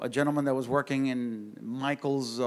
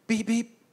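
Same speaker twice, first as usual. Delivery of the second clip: horn beeps; 8 semitones higher than usual. Retold or imitated. Imitating honking horn.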